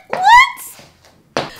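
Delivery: very high, loud squeal of disbelief; high-pitched what; shocked what. Retold or imitated. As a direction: react in total astonishment. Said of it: "I said".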